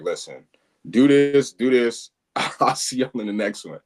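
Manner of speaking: loud clipped orders, barked out; staccato commands. Retold or imitated. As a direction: bark short commands at the viewer. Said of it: "It was very staccato".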